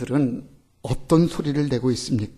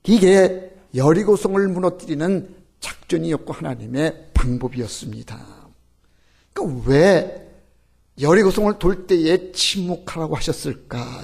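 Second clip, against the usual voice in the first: ordinary talk, nothing marked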